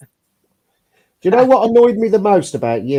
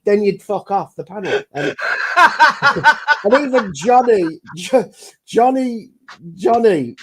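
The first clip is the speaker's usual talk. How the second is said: ordinary delivery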